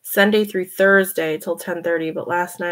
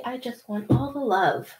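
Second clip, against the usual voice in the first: a touch softer, gently voicing the cat's wish; 3 semitones higher, 6 dB quieter than usual. Speaking for her cat who wants cuddles, mildly voiced. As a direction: put words in the kitty's mouth, lightly.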